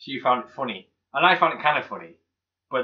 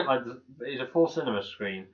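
ordinary talk

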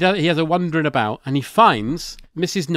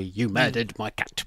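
soft, gentle accusation, lower; very nicely; 6 semitones lower, 7 dB quieter than usual. Retold or imitated. Filch accusing Harry, said very nicely and softly. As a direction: say it nicely, almost tender. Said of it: "he says very nicely".

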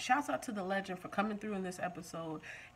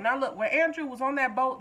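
ordinary talk

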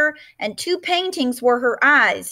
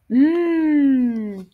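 yummy hum; 3 semitones higher than usual. A narrator's reading. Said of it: "eating beans".

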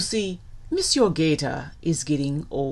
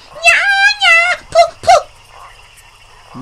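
high, loud nya-nya jeer; childish taunt; 13 dB louder, much slower than usual. Acted. Otter makes fun of gator, childish jeering. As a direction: squeal it with tongue out. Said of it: "sticks his tongue out of his mouth and goes".

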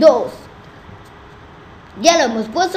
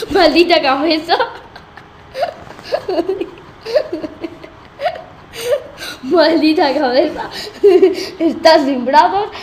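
laughing. Real, not acted. Cracking up at the headless ragdoll.

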